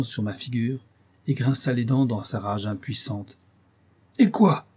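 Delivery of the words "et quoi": high, loud outcry of the creature; cried out. A narrator's reading. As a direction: cry it out in despair. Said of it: "s'écria-t-il". (cri aigu et fort du monstre désespéré)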